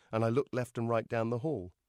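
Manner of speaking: plain reading voice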